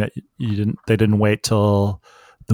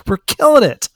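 cocky fan; 13 semitones higher, 4 dB louder than usual. Retold or imitated. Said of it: "'Cause you'd be like".

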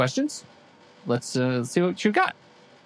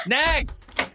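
usual speaking voice

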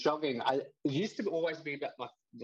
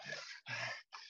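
heavy breathing sound; 12 dB quieter than usual. Shown as a demonstration. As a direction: breathe out hard like after a run. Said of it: "like this".

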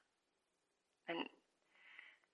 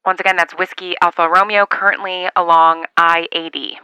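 over a radio; 4 dB louder than usual. Acted. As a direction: announce the call sign carefully. Calling out on CB radio.

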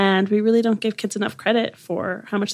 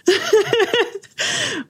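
high laugh; 12 semitones higher than usual. Real, not acted. Teasing her co-host.

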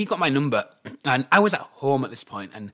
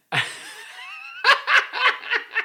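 very high squealing burst of laughter; shrieking laugh. Real, not acted. At the ex's threat, shrieking laugh. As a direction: let a shrill laugh break out.

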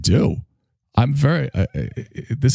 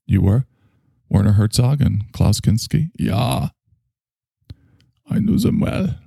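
usual speaking voice

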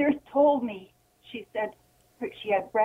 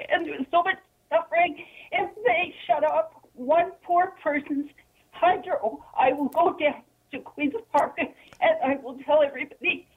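real distressed outburst, higher-pitched; very upset; 4 semitones higher than usual. Real, not acted. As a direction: plead in rising, tearful indignation. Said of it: "I can see that you're very upset".

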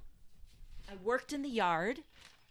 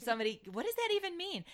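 higher, baffled; incredulous; 9 semitones higher, faster than usual. Retold or imitated. Retelling reaction to grandma, higher and incredulous. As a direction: act truly bewildered.

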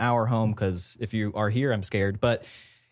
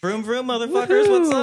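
much higher, loud revved-up entrance; hyped race car driver; 11 semitones higher, 4 dB louder than usual. Pretend. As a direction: burst in like a cocky racer. [name] introduced as race car driver.

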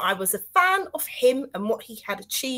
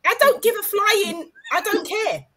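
high, indignant playful rant; mock outrage; 10 semitones higher than usual. Host telling off friends who are going away, mock outrage.

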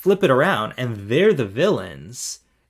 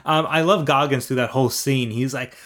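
ordinary talk, nothing marked

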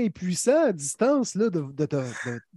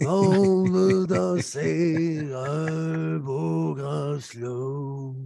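very slow raspy rock crooning; 2 semitones lower, much slower than usual. Pretend. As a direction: rasp it out like a rock ballad singer. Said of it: "pour avoir la même voix rocailleuse".

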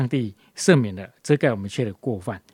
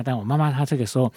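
ordinary talk

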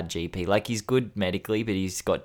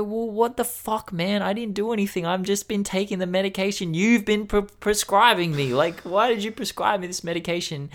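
exasperated outburst; 10 semitones higher than usual. Retold or imitated. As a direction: whine it out like you can't believe it. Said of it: "And I go".

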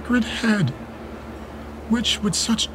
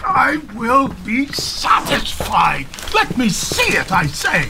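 furious demand; 6 semitones higher than usual. Acted. Father commanding his son to hand over the letter, shouting. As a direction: roar the demand at the boy.

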